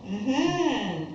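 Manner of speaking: loud burst of laughter, then laughing agreement. Real, not acted. Laughs at the tapping question.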